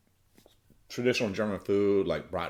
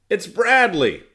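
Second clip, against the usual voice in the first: high loud shout of discovery; excited shout; retold shout; 13 semitones higher, 12 dB louder, slower than usual. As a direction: yell it out like a buddy who just spotted him.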